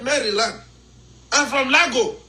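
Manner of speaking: ordinary talk, nothing marked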